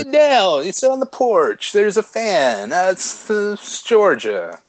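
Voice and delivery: high, laid-back Southern drawl; charming Southern accent. Acted. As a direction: put on a sweet porch-sitting Southern accent. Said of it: "more charming kind of laid back".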